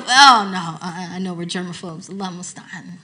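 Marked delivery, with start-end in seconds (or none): none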